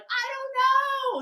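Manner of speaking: high-pitched put-on whine; mock helpless reply. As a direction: imitate a helpless hiker in falsetto. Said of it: "she's like".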